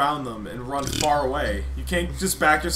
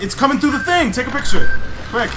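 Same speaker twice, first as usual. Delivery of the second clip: high, loud urgent urging; being chased; 7 semitones higher, 8 dB louder than usual. Real, not acted. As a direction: hurry a friend in panic. Monster approaching.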